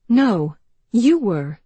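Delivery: slow, loud and deliberate; slow, firm contradiction. Acted. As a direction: plant each word firmly.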